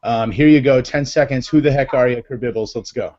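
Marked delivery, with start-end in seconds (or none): none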